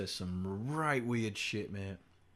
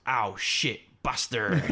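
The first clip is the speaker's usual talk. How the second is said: louder mock-pained swearing; dad cursing in pain; 2 semitones higher, 5 dB louder, slower than usual. Imitating dad banging his head, mock-pained cursing. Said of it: "every time, without fail, he goes".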